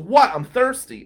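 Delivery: loud, high, defensive comeback; indignant retort. Retold or imitated. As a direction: protest as if wrongly accused. Lawyer caught at broken fountain retorts.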